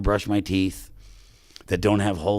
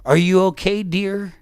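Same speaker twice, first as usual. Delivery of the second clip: little woman asking; 10 semitones higher, 4 dB louder than usual. Retold or imitated. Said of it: "this little woman".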